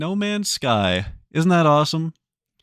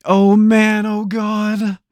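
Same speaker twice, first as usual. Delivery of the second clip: alarmed; 7 semitones higher, 7 dB louder, much slower than usual. Real, not acted. Losing the puzzle race.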